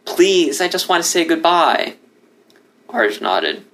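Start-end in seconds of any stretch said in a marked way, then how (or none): 0.0-1.9 higher, fighting back tears; pleading to say goodbye, voice barely held; held-back emotion. Acted. Son asks the lion to call home, choking back emotion. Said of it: "struggled to control the overwhelming emotion in his voice".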